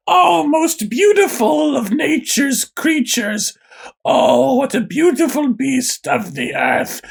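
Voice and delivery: high, slow, syrupy sing-song sweetness; sweet Disney princess. Acted. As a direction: coo at the bird like a fairy-tale princess. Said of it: "like a Disney princess".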